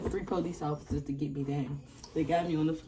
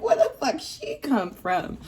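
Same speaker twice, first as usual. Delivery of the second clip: mock tearful surprise; 7 semitones higher, 4 dB louder, slower than usual. Reenacting crying surprise at mother.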